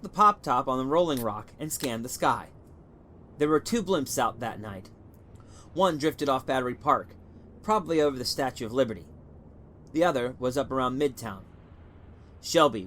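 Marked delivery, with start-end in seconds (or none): none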